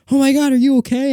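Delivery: high, loud worried call; a startled stranger rushing over; alarmed plumber. Retold or imitated. Plumber runs out to the crying kid, high alarmed call. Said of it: "He's like".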